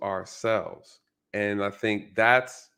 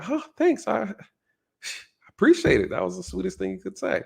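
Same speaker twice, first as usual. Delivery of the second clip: high-pitched, oblivious gratitude; hammed-up high thank-you; naively grateful; 8 semitones higher than usual. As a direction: act sweetly flattered, pitch way up. Plays oblivious listener thanking the insulter.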